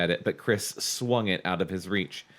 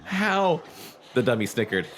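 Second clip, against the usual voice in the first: ordinary talk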